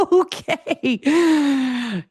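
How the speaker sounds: real laugh, then recovering; laughing at herself. Real, not acted. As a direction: laugh off the overshare. Laughs at own confession.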